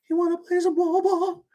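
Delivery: very high, silly put-on voice mocking a prisoner gone mad; mock R. Kelly voice. Streamer mocking R. Kelly in prison, high silly voice. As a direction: squeak it out like a man who lost his mind. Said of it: "he might be like".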